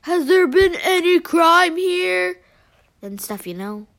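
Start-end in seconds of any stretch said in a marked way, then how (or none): 0.0-2.4 loud, slow, put-on interviewer voice; questioning cop. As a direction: boom it out like a reporter. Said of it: "they're gonna be like".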